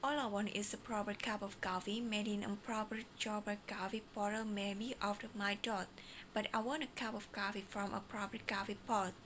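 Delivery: very fast rattled-off twister; fast mode. Shown as a demonstration. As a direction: race through every word. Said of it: "The first mode".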